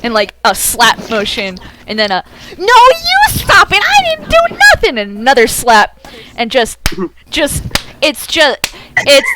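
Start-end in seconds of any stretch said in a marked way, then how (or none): 2.6-5.0 very high, loud cartoon whine; bickering Toonkind voice. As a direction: squeal back in cartoon protest. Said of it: "You hear a perfectly normal Toonkind voice go".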